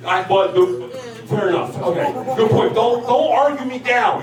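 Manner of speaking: ordinary delivery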